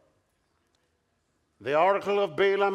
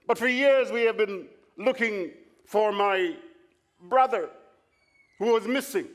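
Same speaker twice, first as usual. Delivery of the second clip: higher, louder fake tearful confession; mock weeping; 5 semitones higher, 4 dB louder than usual. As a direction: pretend to choke up with grief. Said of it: "Tommy breaks down into weeping".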